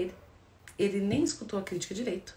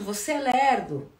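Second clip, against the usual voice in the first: accusing; 4 semitones higher, 6 dB louder, slower than usual. Shown as a demonstration. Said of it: "Quando eu aponto".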